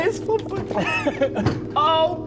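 high, slow, through laughter; laughing. Real, not acted. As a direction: giggle the words out. Laughing at his discomfort.

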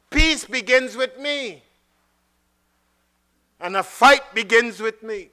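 high, loud, stress on peace and fight; preached contrast. Real, not acted. Preacher contrasting peace and fight, loud. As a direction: punch each first word.

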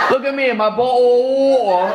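high, drawn-out mock British vowels; mock British accent. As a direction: drag the last vowel in a mocking British accent. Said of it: "British people just say fuck consonants".

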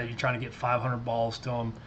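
ordinary delivery

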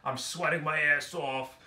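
slow, labored mock complaint; mock overheated worker. Men slogging through a sweaty workday, mock exhausted. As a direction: groan it out like a man dying of heat. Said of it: "dying and slugging around during work like".

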